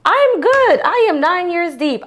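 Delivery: high, punched-out retort; emphatic reassurance. Retold or imitated. Wife answering worried Haitian women, emphatically assured. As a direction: insist firmly, brushing off the worry.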